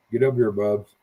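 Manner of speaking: ordinary delivery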